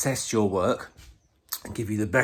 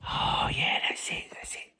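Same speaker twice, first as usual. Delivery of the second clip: hushed, whispered aside to self; whispered approval; 4 dB quieter than usual. Acted. Ernie checking his new camera position, whispered satisfaction. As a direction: whisper it, pleased with the result.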